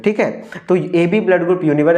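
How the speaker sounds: usual speaking voice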